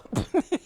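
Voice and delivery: a short high burst of mocking laughter. Real, not acted. A narrator laughing at the villain's fake sorrow, high and quick.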